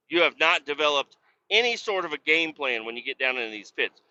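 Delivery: ordinary talk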